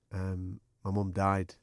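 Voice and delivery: low, very quiet, halting; subdued bereaved reply. Retold or imitated. Colleague telling host his mum died, subdued. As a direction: imitate a grieving man, hushed and heavy.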